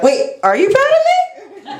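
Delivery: high-pitched, hopeful plea for approval; squeaky-high comic insistence; mock eager. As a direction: push the question way up, hammed-up eagerness. Mock-hopeful repeat of question to mom.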